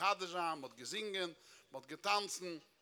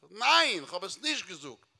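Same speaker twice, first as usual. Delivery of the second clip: emphatic denial; 9 dB louder than usual. Retold or imitated. Denying what was said before, loud protest. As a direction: fire back a loud no.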